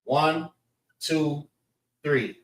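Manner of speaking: one... two... three, spaced apart; measured teacher's count; counting syllables. Shown as a demonstration. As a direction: count out slowly with pauses, falling on three. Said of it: "See the three syllables?".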